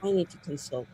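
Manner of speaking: ordinary talk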